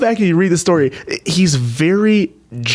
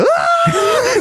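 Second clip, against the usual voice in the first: mock terrified scream. People terrified by angel appearing.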